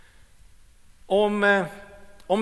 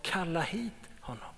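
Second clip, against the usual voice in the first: gentle command; 11 dB quieter than usual. Retold or imitated. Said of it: "Men Jesus sa".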